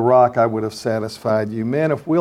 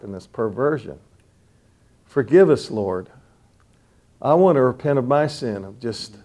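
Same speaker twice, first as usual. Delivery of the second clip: earnest prayer. Acted. Leads the men in prayer.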